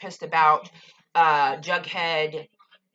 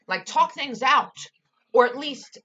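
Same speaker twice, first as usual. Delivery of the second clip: exasperated plea; 7 semitones higher, 5 dB louder than usual. Real, not acted. Telling couple to talk.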